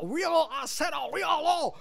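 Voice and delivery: high-pitched accented director impression; John Woo. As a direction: imitate an insistent director from afar. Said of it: "John would scream from the bottom".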